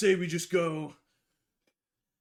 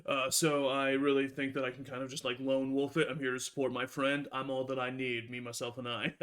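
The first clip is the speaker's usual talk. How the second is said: smug self-assurance; 6 semitones lower, 4 dB quieter than usual. Acted. Rogue brushes off Helena.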